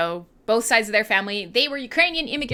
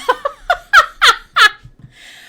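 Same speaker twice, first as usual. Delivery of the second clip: very high, loud burst of laughter; shrieking laugh; 11 dB louder than usual. Real, not acted. Host shrieking with laughter at her own dirty joke. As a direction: cackle at your own joke.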